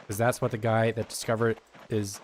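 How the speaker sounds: ordinary talk, nothing marked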